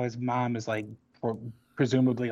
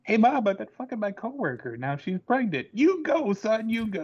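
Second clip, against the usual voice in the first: high, cheery silly voices; hammed-up proud mom; mock son and mom; 11 semitones higher, 3 dB louder than usual.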